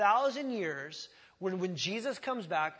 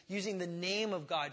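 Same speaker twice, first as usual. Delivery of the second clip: usual speaking voice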